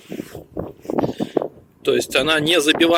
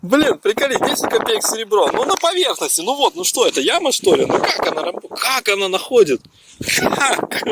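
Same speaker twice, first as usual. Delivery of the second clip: amazed; 7 semitones higher than usual. Real, not acted. Amazed at surface finds.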